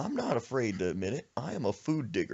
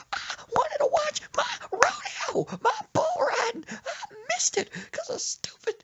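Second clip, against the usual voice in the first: falling-out wail. Retold or imitated. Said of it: "she falls out".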